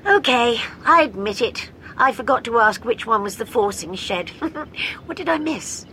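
Jo, sheepish. A narrator's reading. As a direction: own up with a bashful grin. Jo confesses to Doctor.

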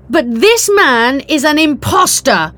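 high, loud public accusation. A narrator's reading. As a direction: denounce him to the whole room. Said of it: "she said loudly".

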